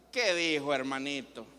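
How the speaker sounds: low, falling, patronizing mockery; condescending tease, sinking in pitch; mocking. Shown as a demonstration. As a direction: talk down as if to a simpleton. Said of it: "me estoy burlando".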